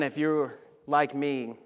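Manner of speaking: ordinary talk, nothing marked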